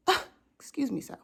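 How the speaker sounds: a hushed scandalised excuse me; offended whisper. Acted. Indignant aside to dad.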